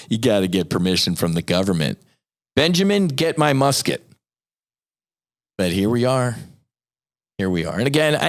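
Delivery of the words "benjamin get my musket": a founding father calling out, lightly voiced; mock founding father. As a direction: play an outraged colonial patriot, a hint only.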